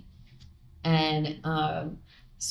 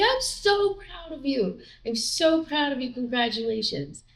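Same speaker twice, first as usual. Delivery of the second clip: proud gushing friend; 8 semitones higher than usual. Retold or imitated. Friend hugging her proudly.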